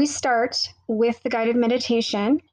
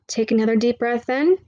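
right in your ear. Acted. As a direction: guide the listener into calm. Meditation guide begins.

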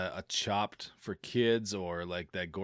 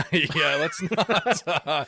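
laughing while saying it, higher and louder; 7 semitones higher, 7 dB louder, slower than usual. Real, not acted. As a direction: get the words out through laughter. Laughing about Chad joke.

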